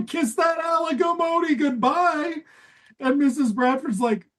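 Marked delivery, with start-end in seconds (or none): 0.1-2.4 high jeering dad voice; hammed-up gloating husband; mocking dad. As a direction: taunt her gleefully. Dad mocks wife's lover.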